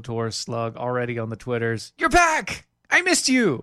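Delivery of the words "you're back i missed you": excited. Real, not acted. Greeting cohost on return.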